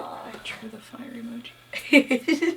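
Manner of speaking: slow, whispered aside. Real, not acted. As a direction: whisper it like a small secret. Playful aside about the drawing.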